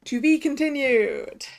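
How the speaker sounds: slow, drawn-out cliffhanger line; slow and grand; dramatic announcement. Acted. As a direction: stretch it out for suspense. Reads episode's closing card dramatically.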